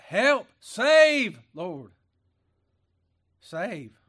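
high, very loud cry for rescue. Acted. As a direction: call out to God at full voice. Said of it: "Or we cry".

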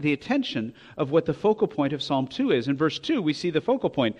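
ordinary delivery

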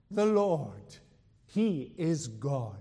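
loud, slow proclamation; exultant shout. Retold or imitated. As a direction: cry it out like the crowd on Carmel. Crowd's cry repeated.

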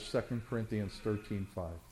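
gently repeated reference. Real, not acted.

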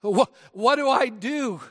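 a helpless husband yelling for help; panicked call. Retold or imitated. Preacher acting a flustered husband calling his wife, mock panic. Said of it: "we call out".

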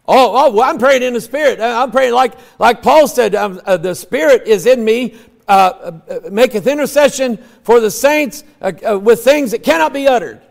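high, loud, stammering mock believer; hammed-up protest full of uhs; mocking imitation. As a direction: imitate a flustered defender fumbling for scripture. Mock quote of tongues believers.

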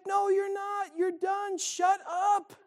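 high mock wife snapping back; wife shuts down her boastful husband, high mock voice; fed-up wife. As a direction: cut him off like an annoyed wife. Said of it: "And she's like".

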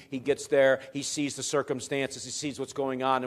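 ordinary talk, nothing marked

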